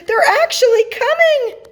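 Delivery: very high, loud excited squeal; thrilled disbelief. A narrator's reading. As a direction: cry it out, overjoyed and amazed. Moon thrilled at visitors arriving.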